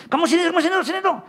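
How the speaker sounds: high, loud call to come here. Retold or imitated. Preacher calling his hiding wife over, high. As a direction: beckon her out from behind the pulpit.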